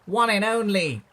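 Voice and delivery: high, loud, celebratory chant; triumphant storybook hurrah; happy hooting cheer. A narrator's reading. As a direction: cheer the hero with stretched-out words. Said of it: "the duckbills hooted happily".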